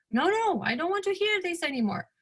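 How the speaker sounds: a quick imitation of her brushing Mom off; mock refusal. Daughter refuses mother's advice.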